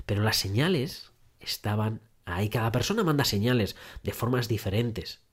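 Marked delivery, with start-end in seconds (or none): none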